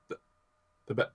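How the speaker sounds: usual speaking voice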